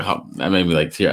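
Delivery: ordinary talk, nothing marked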